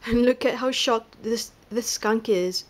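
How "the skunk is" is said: usual speaking voice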